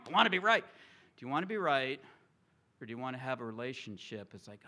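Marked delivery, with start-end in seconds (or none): none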